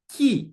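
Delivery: ki said alone as the stressed syllable; ki, a firm lone syllable; stressed first syllable. Shown as a demonstration. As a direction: isolate ki with emphasis. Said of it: "stress will be on the first syllable".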